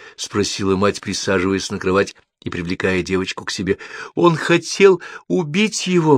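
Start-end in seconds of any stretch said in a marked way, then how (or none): 4.1-6.2 high, drawn-out cry of alarm; distressed exclamation. A narrator's reading. Девочка проснулась от кошмара, distressed exclamation. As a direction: cry out slowly, pitched way up. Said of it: "воскликнула девочка".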